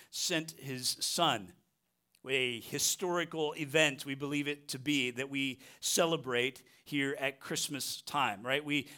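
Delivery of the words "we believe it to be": usual speaking voice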